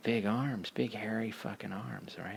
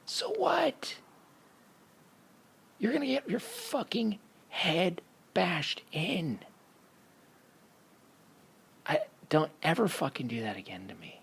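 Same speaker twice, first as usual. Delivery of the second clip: pissed; 10 semitones higher than usual. Retold or imitated. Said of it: "He was pissed".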